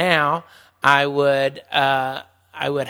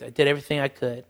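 ordinary talk, nothing marked

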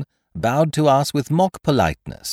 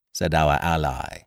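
ordinary delivery

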